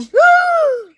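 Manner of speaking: a shrieking, very high, very loud gasp; piercing high gasp; huge gasp. Retold or imitated. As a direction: gasp so hard it startles the driver. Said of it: "I'm really dizzy from that gasp".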